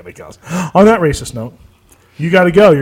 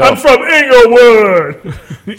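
slow, loud, laughing boast; hammed-up tough guy voice; 7 semitones higher, 4 dB louder, much slower than usual. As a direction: stretch it out proudly through a laugh.

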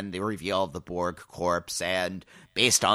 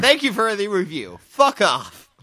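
mock dismissive; 11 semitones higher, 5 dB louder than usual. Joking brush-off of a listener.

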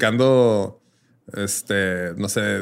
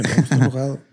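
mock angry; 4 semitones higher than usual. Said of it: "No te enojes".

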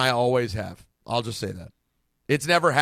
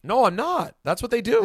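higher and louder, insisting; loud insistence; 9 semitones higher, 6 dB louder than usual. Real, not acted. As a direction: protest it loudly.

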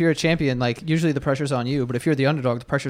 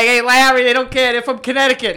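high, raised Boston barman voice; thick Boston accent; 9 semitones higher, 4 dB louder than usual. Retold or imitated. Bartender setting Larry straight about the visitors, thick Boston accent. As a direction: call down the bar in broad Boston. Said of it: "had the thickest Boston accent in the world".

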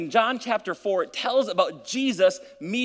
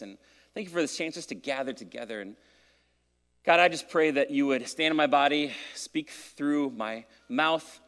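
hushed, earnest prayer voice; 5 semitones lower, 3 dB quieter than usual. Real, not acted. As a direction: pray softly over the congregation. Pastor leads opening prayer.

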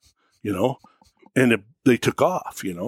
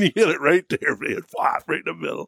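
ordinary talk, nothing marked

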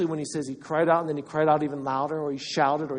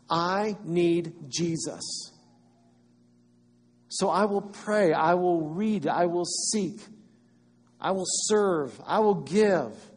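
slow, louder, fervent declarations; fervent vow; 3 semitones higher, 4 dB louder, slower than usual. Retold or imitated. Believer declaring devotion, slow and fervent. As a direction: vow it with rising conviction. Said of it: "that blind beggar who says".

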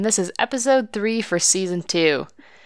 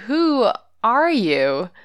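drawn-out, higher-pitched incredulous question; mock disbelief; 5 semitones higher, much slower than usual. Sister to her frantic sibling in the car, mock disbelief. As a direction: imitate a baffled sister, stretching each word slowly.

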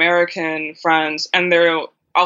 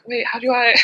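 puzzled; 6 semitones higher than usual. Retold or imitated. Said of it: "I'm like".

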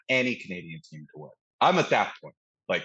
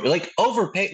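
higher, incredulous echo of a complaint; mock indignant fan; 9 semitones higher than usual. Oilers fan reacting to the trade, mock indignant. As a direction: throw it back in disbelief.

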